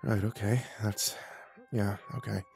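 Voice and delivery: soft, halting mumble; faint mumble. Acted. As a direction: trail along weakly, at a loss. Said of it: "Faintly, I mumbled".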